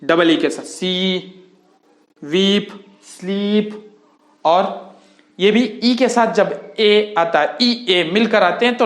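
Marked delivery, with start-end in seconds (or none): 2.2-4.0 वीप and स्लीप said slowly, the ee vowel stretched long; slow teacher demo of the long ee in वीप, स्लीप; long ee sound. Shown as a demonstration. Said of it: "long आवाज".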